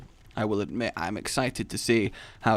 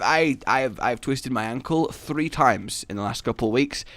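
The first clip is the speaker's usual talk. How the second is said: ordinary talk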